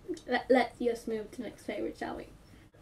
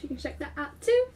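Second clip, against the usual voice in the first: normal talking voice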